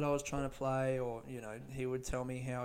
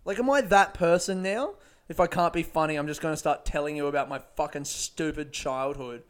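ordinary talk

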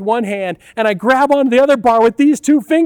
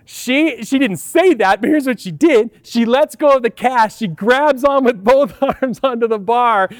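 ordinary delivery

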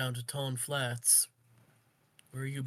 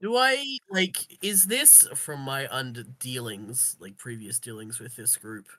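ordinary talk, nothing marked

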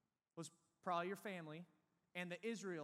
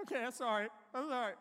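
panicked apology; 4 semitones higher, 7 dB louder than usual. Retold or imitated. Pleading with the bully.

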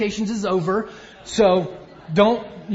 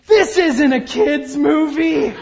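imitating a dismayed woman, slow and louder; mock-distressed moviegoer, stretched out and raised; upset woman moviegoer; 4 semitones higher, 6 dB louder, slower than usual. Woman behind him at a sad dog movie, mock dismay. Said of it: "I hear a woman behind me say".

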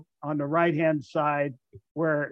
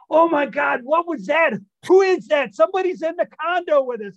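high, loud frightened shouting; startled panic; 10 semitones higher, 6 dB louder than usual. Retold or imitated. Poker players hearing an intruder, shouting in high panic. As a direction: shriek it like someone's in the house. Friends startled by thrown brush.

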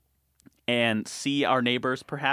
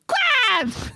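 very high, loud cheer; sudden falsetto burst; exultant inflection; 5 dB louder than usual. Shown as a demonstration. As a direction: shout it like a touchdown was scored. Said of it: "hoping that my voice inflection shows this".

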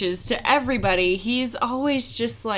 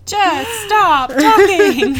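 slow, high, loud and laughing; laughing exasperation; 8 semitones higher, 7 dB louder, much slower than usual. Real, not acted. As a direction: laugh out the words. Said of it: "laughing at line".

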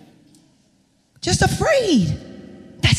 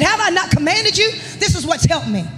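full-force preaching; 5 semitones higher than usual. Acted. Pastor's wife preaching God's command to Joshua to the church, full-force preaching. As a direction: proclaim the verse with rising fervor.

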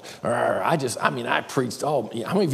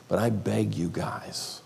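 slow, whispered plea; earnest whisper; 4 dB quieter, slower than usual. Real, not acted. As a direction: beg them softly.

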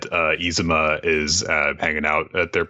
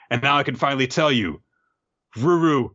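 raised voice, halting dramatic confession; Ren; 8 semitones higher than usual. Acted. Ren confesses to Ruru, high and boldly dramatic. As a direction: build up word by word with bold pauses. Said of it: "brimming with confidence".

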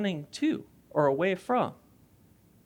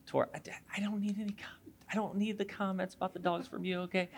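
quick hushed whisper to someone in the front row; whispered aside; 9 dB quieter, faster than usual. Real, not acted. Whispering a teasing aside to Tori in the crowd. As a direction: drop to a fast whisper, teasing.

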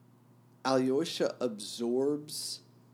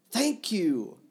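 higher, louder burst of thanks; loud exclamation; 10 semitones higher, 6 dB louder than usual. Acted. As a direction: blurt the thanks out loudly.

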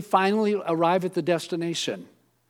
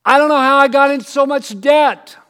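higher, louder mock bewilderment; clueless friend; 8 semitones higher, 9 dB louder than usual. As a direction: play the friend baffled by their own debt. Imitating friend in debt.

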